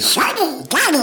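very high squawks breaking into quacked babble; Donald Duck. Retold or imitated. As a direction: trade quacks like two cartoon ducks. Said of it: "talk like Donald Duck".

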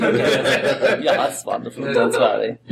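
put-on German accent broken by laughter; weird German accent. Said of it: "I can do a, a weird one".

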